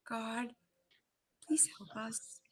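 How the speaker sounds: a frightened whisper to God; whispered prayer. Retold or imitated.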